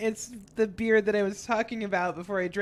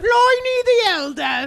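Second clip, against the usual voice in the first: excited; 11 semitones higher, 7 dB louder than usual. Real, not acted. Excited shout of the name.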